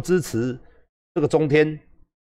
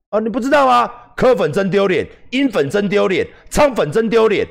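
mocking troll taunts; 8 semitones higher than usual. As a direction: chant the taunts like a gloating troll. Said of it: "类似这样".

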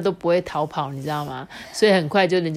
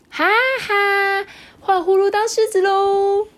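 much higher, louder gleeful call; gleeful tag; 6 dB louder, slower than usual. Acted. Mimi tagging Hulu, high and gleeful. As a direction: gloat playfully after catching him.

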